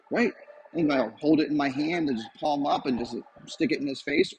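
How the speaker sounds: ordinary delivery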